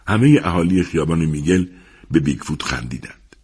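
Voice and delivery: ordinary delivery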